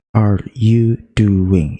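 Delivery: very slow, word by word; slow demo. Shown as a demonstration. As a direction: spell it out slowly for beginners. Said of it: "Kita penggal aja". (pelan sekali, kata per kata)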